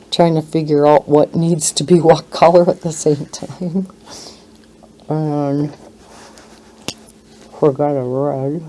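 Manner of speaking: talking around a marker in the mouth; words muffled by a marker held in the mouth. Real, not acted. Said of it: "as I talk with a marker in my mouth".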